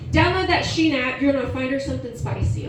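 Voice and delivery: ordinary delivery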